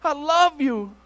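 high, loud imitated plea; crying out to God. Desperate man calling to God from his car, imitated cry. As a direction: call out in drawn-out, pleading bursts. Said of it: "he starts crying out to God".

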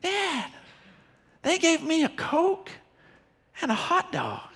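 put-on a small boy thrilled with his free treats; excited child. Five-year-old son telling dad a third time, high and delighted. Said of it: "He says it a third time".

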